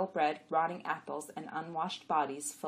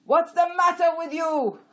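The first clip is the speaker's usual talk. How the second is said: high, loud angry rider; yelling; 13 semitones higher, 15 dB louder than usual. A narrator's reading. Rider to his halted donkey, yelling high. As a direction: yell it at a stubborn animal. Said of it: "rider yelled at her".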